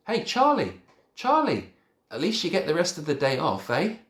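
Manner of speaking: high, loud cheeky shout. A narrator's reading. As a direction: call out cheerily across the room. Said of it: "I shouted".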